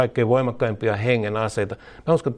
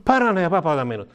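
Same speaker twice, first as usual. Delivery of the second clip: fervent cry; 9 semitones higher than usual. Retold or imitated. Preacher modelling a bold prayer, fervent high cry. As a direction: cry out for help in full faith. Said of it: "Mutta jos sanoo".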